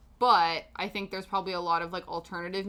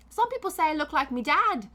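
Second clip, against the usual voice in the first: high, loud, put-on Irish accent; hammed-up Irish lilt, pitched well up; attempted Irish accent; 7 semitones higher, 5 dB louder than usual. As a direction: have a rough go at an Irish accent. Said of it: "approaching my attempt at an Irish accent".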